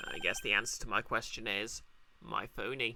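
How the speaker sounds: low, dry defense attorney; deep lawyer voice. Acted. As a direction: drop low like a weary lawyer. Player voicing Phoenix.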